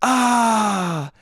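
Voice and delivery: mock cry of pain, trailing downward; pained groan. Hurt woman as boyfriend sizes me up, mock pained groan. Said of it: "just in the middle of her going".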